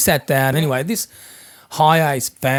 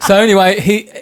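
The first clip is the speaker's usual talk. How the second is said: still laughing; 7 semitones higher, 8 dB louder than usual. Real, not acted. Recovering from laughter.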